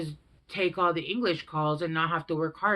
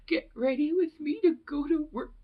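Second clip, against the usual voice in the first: high, wailing mock sob; mock crying; 9 semitones higher than usual. Imitating a crying girl filming a get-ready-with-me video. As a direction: whimper it like a weepy girl doing her makeup. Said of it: "she's crying".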